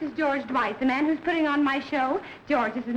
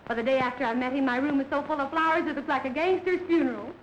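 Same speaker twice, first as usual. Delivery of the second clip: ordinary talk, nothing marked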